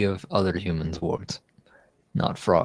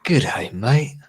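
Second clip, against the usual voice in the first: put-on loud, raised Australian accent; 4 semitones higher, 5 dB louder than usual. Host attempting an accent on request, in broad Australian. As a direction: put on a broad Aussie greeting. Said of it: "can you do an Australian accent?".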